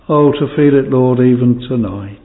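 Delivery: ordinary talk, nothing marked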